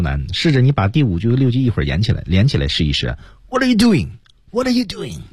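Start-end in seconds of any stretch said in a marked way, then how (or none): 3.5-5.2 嗓子压住，音调很高地挤出来; 像小鸟一样高声挤着嗓子提醒; 压着嗓子. Shown as a demonstration. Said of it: "压着自己的声音向下压，嗓子压". (压嗓高音挤声)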